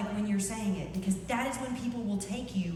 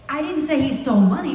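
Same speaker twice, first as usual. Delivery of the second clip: money stressed in a loud line; 5 semitones higher, 10 dB louder than usual. Shown as a demonstration. Speaker showing how meaning changes, stress on money. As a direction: lean on money, hinting at something else. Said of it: "It changes".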